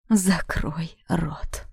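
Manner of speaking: slow, unvoiced threat; menacing whisper. David orders her silent. (угрожающий шёпот)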